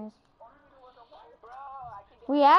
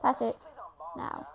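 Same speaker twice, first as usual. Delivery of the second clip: usual speaking voice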